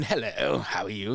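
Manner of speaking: lightly put-on English gentleman's greeting; a hint of a polite English film star; Hugh Grant. Actor trying his English accent for the director, lightly imitated English gentleman. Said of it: "do Hugh Grant".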